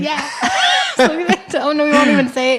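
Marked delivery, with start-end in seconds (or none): 0.3-0.9 high nervous giggle; high laugh. Real, not acted. Nervous laugh about parents' safety.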